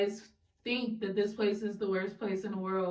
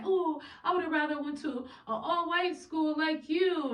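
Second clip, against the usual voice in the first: mimicking locals; 8 semitones higher than usual. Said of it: "locals that will be like".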